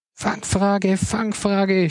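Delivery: mild mock cry of trick question; inner shout. A narrator's reading. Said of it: "schrie es in Moritz".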